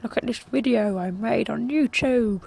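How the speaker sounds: high, slow pupil to teacher; squeaky little schoolboy showing off; schoolkid voice. Pretend. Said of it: "went back to school like this and said".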